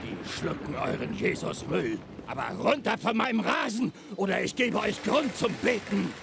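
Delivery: high, furious yelling; angry shouting. Acted. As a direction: yell them off the lawn. Father throws priests out.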